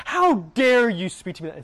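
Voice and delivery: high, loud, offended snap; mock outraged retort; indignant outburst. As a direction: hurl it back like you've been insulted. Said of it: "Rather than".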